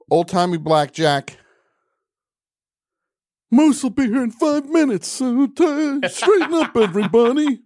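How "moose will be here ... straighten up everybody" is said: high, loud goofy henchman; loud falsetto hype man; put-on sidekick voice. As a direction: warn the bar in a high-pitched put-on voice. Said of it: "You gotta talk like this now".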